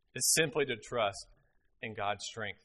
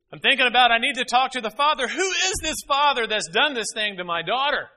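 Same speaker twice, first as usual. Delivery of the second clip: higher, louder rush of alarm; panicked dad; 10 semitones higher, 9 dB louder than usual. Retold or imitated. Father imagining his daughter's pregnancy, panicked. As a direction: spiral into alarm, voice climbing.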